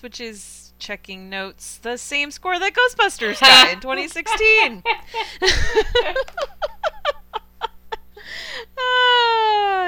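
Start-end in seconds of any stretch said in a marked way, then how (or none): 1.8-4.8 loud, higher, gleeful punchline; triumphant dig; mock reveal. Mock reveal after checking notes. 4.9-9.8 high loud gleeful cackle; delighted laughing fit; real cackle. Real, not acted. Cackling at Joker score.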